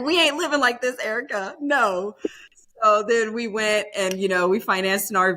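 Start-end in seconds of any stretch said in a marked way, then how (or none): 0.0-2.2 a higher, put-on voice for the friend refusing; friend's firm refusal. Friend telling Erica they're done living like this, in a higher put-on voice. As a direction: say it like the friend laying down the law. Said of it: "She's like".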